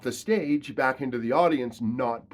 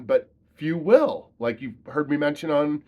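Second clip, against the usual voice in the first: ordinary talk, nothing marked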